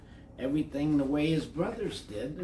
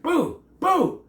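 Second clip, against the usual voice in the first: high loud triumphant shout; 13 semitones higher, 9 dB louder than usual. A narrator's reading. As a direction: yell after the fleeing bat. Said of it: "Ben shouted after".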